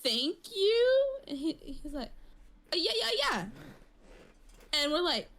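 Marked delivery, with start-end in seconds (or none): 0.0-1.0 much higher, puzzled thank you; baffled thank you. Retold or imitated. Coworkers thanking confused. 2.7-3.5 much higher mumbled yeah yeah; imitating coworker. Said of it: "he speaks a different language".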